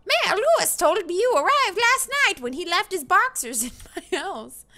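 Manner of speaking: high, loud country villager voice; country accent. Acted. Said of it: "giving them all, like, country accents".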